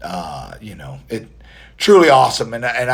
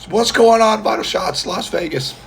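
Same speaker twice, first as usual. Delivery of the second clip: show-opener call; 10 semitones higher, 3 dB louder than usual. Acted. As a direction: launch the catchphrase high, then ease down. Performs his show greeting, high-pitched.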